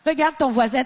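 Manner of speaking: usual speaking voice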